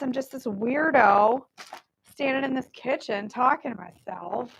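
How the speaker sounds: normal talking voice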